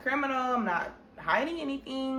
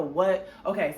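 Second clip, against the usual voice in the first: usual speaking voice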